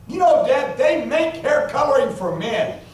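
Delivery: a hint of the innocent daughter, quite sincere. Daughter voiced, earnest suggestion.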